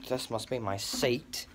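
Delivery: normal talking voice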